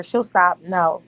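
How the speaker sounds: usual speaking voice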